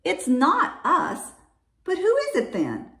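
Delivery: high, emphatic puzzling; puzzled story-bear; bear speaking. A narrator's reading. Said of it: "Bear says".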